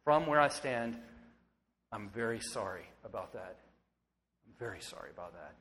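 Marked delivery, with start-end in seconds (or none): 1.9-3.6 soft, sincere apology, voice dropping low; quiet apology. Real, not acted. Pastor to wounded listeners, soft sincere apology. 4.5-5.5 much softer and lower, hushed and quicker; near-whispered apology. Real, not acted. Pastor repeating apology to congregation, hushed and soft.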